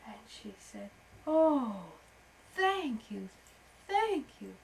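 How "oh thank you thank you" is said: higher-pitched, gushing thanks; relieved gratitude. A narrator's reading. Rescued butterfly to beetle, grateful high voice. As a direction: thank your rescuer with feeling.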